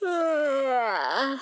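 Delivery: a long drawn-out yawn. Real, not acted. Vlogger yawning mid-ramble to her viewers, sleepy yawn. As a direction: let a real yawn take over the word. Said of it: "sorry for yawning".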